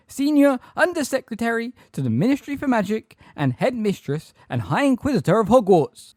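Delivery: loud, very high frightened voice; high-pitched, terrified. A narrator's reading. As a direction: announce yourself in a high, panicky pitch. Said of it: "in a high-pitched, terrified voice".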